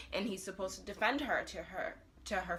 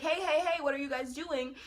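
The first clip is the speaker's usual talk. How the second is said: higher, urgent mock call; 7 semitones higher than usual. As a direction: call after them to stop, imitating. Acts out trying to stop kidnappers.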